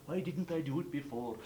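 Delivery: ordinary delivery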